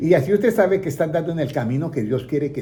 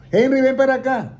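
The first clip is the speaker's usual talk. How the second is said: higher and a bit louder, summoning Henry; calling out; 8 semitones higher, 4 dB louder than usual. Retold or imitated. Someone calling Henry to come over, calling out loudly. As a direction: call his name from across the room.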